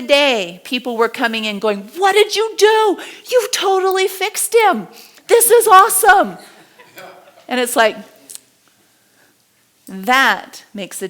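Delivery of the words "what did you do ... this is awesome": higher, excited praise; thrilled employees. Retold or imitated. Delighted employees to the coach, excited. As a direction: gush like the problem's finally solved.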